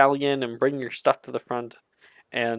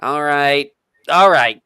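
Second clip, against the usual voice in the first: muffled voice; 3 semitones higher, 10 dB louder than usual. Acted. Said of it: "muffled from inside of the changing room".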